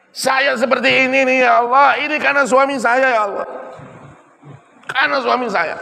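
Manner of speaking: wife crediting her husband, high and imploring; tearful grateful wife. Acted. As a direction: climb high like a wife overcome with gratitude. Wife's voice praising husband.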